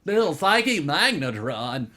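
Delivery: slow, loud nerdy voice; nerd voice. Pretend. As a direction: savor it like a nerd. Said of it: "you have to do the nerd voice".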